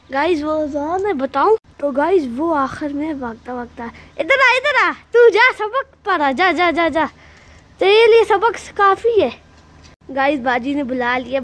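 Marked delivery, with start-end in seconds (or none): 4.2-9.3 shooing call. Real, not acted. Shooing the kid off to study. (ऊँची आवाज़ में बच्चे को डाँटकर भगाना)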